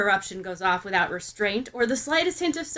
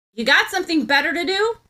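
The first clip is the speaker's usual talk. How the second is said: higher, louder aggressive retort; 7 semitones higher, 6 dB louder, faster than usual. Acted. Bakugo, insulted by her question, fires back, aggressive and loud. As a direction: fire it back at her, offended. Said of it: "fires back an aggressive".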